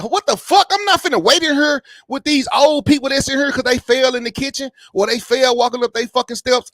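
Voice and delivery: high-pitched, alarmed rush of words; retold panic. Panicking in the waiting room over his heart number, high and alarmed. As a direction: replay the hospital freak-out, voice jumping up. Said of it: "So I'm in the hospital panicking now".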